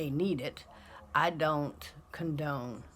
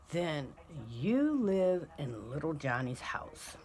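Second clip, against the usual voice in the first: normal talking voice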